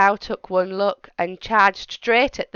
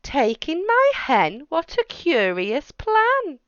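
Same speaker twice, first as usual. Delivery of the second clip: high, puzzled questioning; baffled by a strange idea; puzzled old lady; 7 semitones higher than usual. Acted. Old lady questioning the wise man's advice, puzzled.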